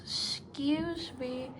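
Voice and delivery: slow, high, polite little voice; elephant's child asking the crocodile politely. Acted. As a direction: ask it gently like a well-mannered child. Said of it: "said the elephant's child most politely".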